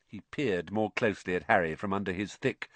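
plain reading voice

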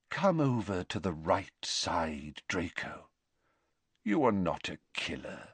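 hushed, earnest repeat; soft earnest plea. Acted. Dumbledore repeats, softer.

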